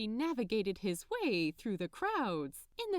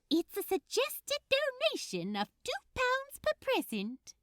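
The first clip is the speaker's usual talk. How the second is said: subdued elf. Acted. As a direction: still much higher, a touch calmer. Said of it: "a little more subdued".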